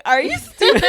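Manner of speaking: high-pitched question breaking into laughter; mock incredulity. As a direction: imitate a stunned friend, cracking up. Friend's incredulous reply, retold laughing.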